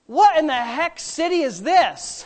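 high, loud, slow retold bafflement; baffled outburst. Retelling his own blurt on seeing the capital, baffled. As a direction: blurt it out in mock bewilderment.

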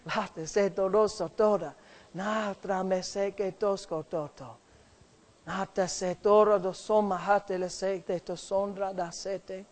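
slow, soft flowing tongues; quiet glossolalia prayer; praying in tongues. Real, not acted. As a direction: murmur the syllables prayerfully. Pastor prays in tongues.

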